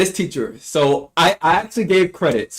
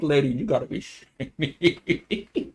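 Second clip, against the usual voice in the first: slow, softer, breaking up into laughter; laughing disbelief; 6 dB quieter than usual. Real, not acted. Student mocking the teacher's tearful tangent, laughing in disbelief. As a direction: lose it laughing by the end.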